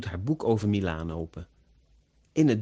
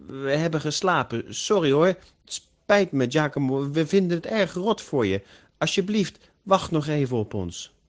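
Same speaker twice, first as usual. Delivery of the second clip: higher-pitched, pleading apology on the phone; apologetic caller; 5 semitones higher, 3 dB louder than usual. A narrator's reading. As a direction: plead with a friend to forgive and wait.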